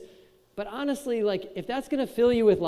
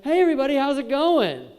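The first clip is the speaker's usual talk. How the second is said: higher, louder mock stage greeting; hyped speaker greeting; 6 semitones higher, 6 dB louder than usual. Speaker greeting the crowd, hammed-up enthusiasm. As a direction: pump up the room like a cheesy host. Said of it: "It's like".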